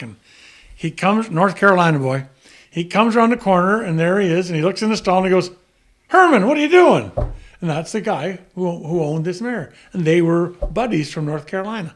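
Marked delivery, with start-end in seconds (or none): 6.1-7.1 high, loud surprised call. Retold or imitated. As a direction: call out in surprise at an old friend. Friend calls out surprised.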